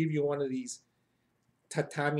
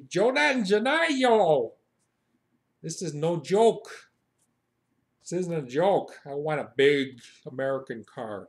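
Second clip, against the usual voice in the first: demanding complaint; 4 dB louder than usual. Acted. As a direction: make a scene at the counter. Demanding at rental car counter.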